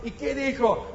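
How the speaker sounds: usual speaking voice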